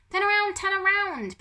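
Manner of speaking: high, loud urgent cry; frantic shout. A narrator's reading. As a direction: yell it in a panic. Pickering slapping Humphrey's backside.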